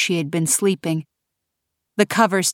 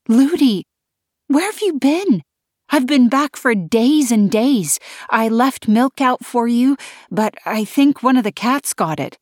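higher, louder eager greeting; excited; 6 semitones higher, 3 dB louder than usual. Acted. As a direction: gush at old friends. Kay greets fairy friends.

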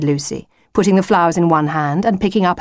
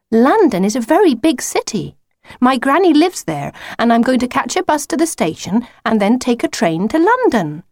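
put-on higher-pitched, clear girl's voice; matter-of-fact Lucy, explaining; 7 semitones higher than usual. Lucy describing her journey to the wolf, explaining.

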